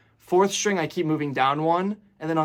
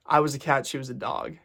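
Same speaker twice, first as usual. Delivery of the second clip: ordinary talk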